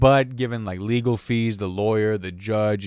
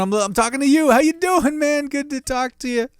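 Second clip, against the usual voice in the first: goofy high greeting. Pretend. Talking alone to podcast listeners, very high goofy voice. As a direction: greet them like a cartoon buddy.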